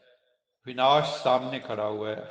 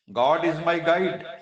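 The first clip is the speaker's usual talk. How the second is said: "God is my guide" said very slowly, higher and louder; slow proud declaration; 4 semitones higher, 5 dB louder, much slower than usual. Real, not acted. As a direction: spell out every word with joy. (बहुत धीरे, ऊँचे और ज़ोर से गर्व के साथ)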